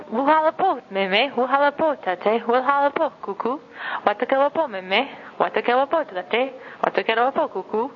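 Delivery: drilling greetings for elders, lifted and lively; Oshindonga greetings drill. Shown as a demonstration. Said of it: "so practice saying".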